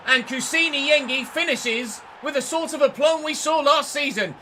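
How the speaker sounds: loud, high, thrilled commentary; excited goal call. Real, not acted. Commentator celebrating a goal for his team, loud and thrilled. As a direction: yell it out as the striker finally scores. Striker scores a goal, live reaction.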